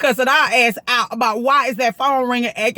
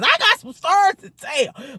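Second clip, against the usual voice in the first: higher, playful second take; teasing repeat; 7 semitones higher than usual. Shown as a demonstration. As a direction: tease it higher, like a secret.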